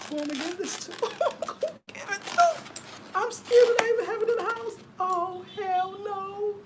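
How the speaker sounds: high, horrified disbelief with a laugh; shocked dismay. Real, not acted. Vlogger reacting to a slaughtered cow gift, horrified. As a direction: recoil from the gift in horror.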